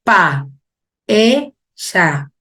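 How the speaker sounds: "Pa, e, ya" in three separate beats; syllable by syllable. Shown as a demonstration. Teacher reading written examples aloud, "Pa, e, ya" split apart. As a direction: say "Pa, e, ya" with a gap after each syllable. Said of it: "analizando oralmente".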